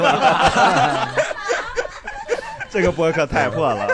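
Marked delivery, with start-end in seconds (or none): 1.1-2.7 尖声爆笑. Real, not acted.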